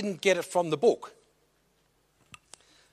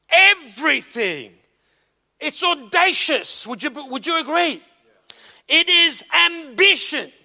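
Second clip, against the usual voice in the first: loud rising sermon cadence; fervent preaching; 10 semitones higher, 5 dB louder than usual. Acted. Pastor exhorting the congregation, fervent preaching. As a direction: preach it with conviction.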